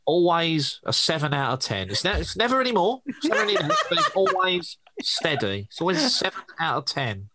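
high nasal pinched-nose voice; impression of Brad. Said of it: "I'm gonna be Brad".

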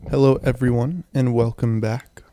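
normal talking voice

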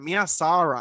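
Mia Sara with Spanish vowels, Sah-rah; Spanish accent. Shown as a demonstration.

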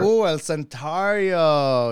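loud, slow, drawn-out relish; excited approval. Real, not acted. Host cheering the tequila choice, excited. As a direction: stretch it out, impressed.

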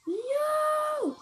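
high loud cry of dismay; gamer's loud no; shocked shout. Real, not acted. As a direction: yelp it out. Getting killed in game.

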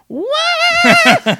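high, loud bird squawk; parrot squawk. Retold or imitated. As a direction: screech it like a parrot. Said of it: "the fucking bird starts going".

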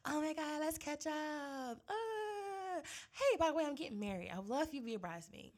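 high, gushing fake-friendly voice; mock bubbly friend. Imitating the old friend who suddenly asks her to be a bridesmaid, high and gushing. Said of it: "And it's just like".

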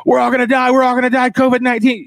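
high mock panic at breakfast; hammed-up doomsayer at the table; mock alarm, high pitched; doom-saying friend. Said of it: "some guy's like".

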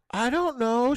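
high-pitched imitated child voice; daughter's excuse. Daughter answers parents after slap, high mock excuse. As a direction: play a kid pleading ignorance.

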